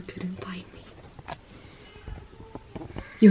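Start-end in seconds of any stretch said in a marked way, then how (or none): 0.0-0.8 whispering. Real, not acted. Hiding, whispering to kids.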